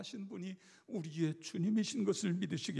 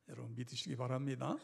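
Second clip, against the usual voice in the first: normal talking voice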